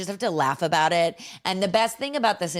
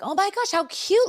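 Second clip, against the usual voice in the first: high-pitched gush of delight; delighted cooing; 12 semitones higher than usual. Retold or imitated. Naive mother admiring another little girl, delighted cooing. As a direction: coo it high, thrilled by the cuteness.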